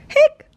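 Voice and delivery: sharp high hiccup sound; a little squeaky hic. Acted. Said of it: "Oh no!".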